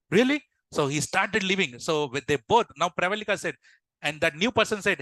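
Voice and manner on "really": high, rising surprised question; acted-out astonished disbelief. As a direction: pitch jumps up in happy disbelief. Manager disbelieves the sudden offer.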